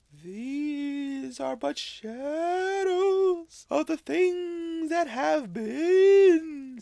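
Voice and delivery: high, slow, otherworldly spirit; ghostly spirit voice. Acted. Ghost of Christmas Past solemnly summoning Scrooge, high and slow. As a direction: speak from beyond, high and drawn out.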